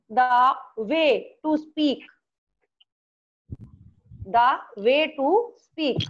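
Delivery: slow, measured dictation; dictating. Real, not acted. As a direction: say it slowly for pupils writing it down. Teacher dictates meaning to write down.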